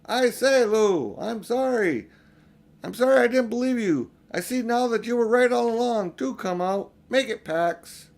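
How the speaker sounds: loud, high calling apology; a boy calling out his sorry, high and loud; shouting again. Acted. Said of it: "so he shouted again".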